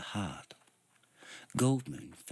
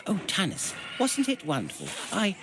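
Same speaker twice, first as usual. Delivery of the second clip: much higher, louder delight; excited gush; 12 semitones higher, 4 dB louder than usual. A narrator's reading. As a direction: bubble over with wonder. Tass gushes about the adventure.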